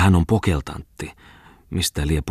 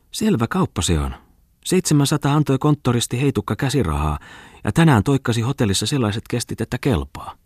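raised, lively woman's voice, bragging; boastful hostess; 7 semitones higher than usual. A narrator's reading. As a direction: brag about the deal like a sly hostess. Narrator voices Aina Kustava. (Aina Kustava kehuu kauppaa kohotetulla äänellä)

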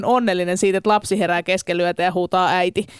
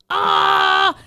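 frustrated scream; 9 semitones higher than usual. Retold or imitated. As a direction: let out a mock cry of despair. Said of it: "Kyllä sitä saa tarpeekseen". (turhautunut, korkea kiljaisu)

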